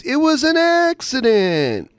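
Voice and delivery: very high, drawn-out mimicry; cartoonish catchphrase. Co-host quotes the guest's catchphrase, high cartoon mimicry. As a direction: squeal it slowly like a cartoon. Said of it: "He has catchphrases like he's a cartoon character".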